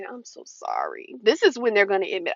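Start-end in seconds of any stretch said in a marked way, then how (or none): 0.0-1.1 much lower, softer, slow crooning apology; fake sweet apology. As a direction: sweeten it to lure her back.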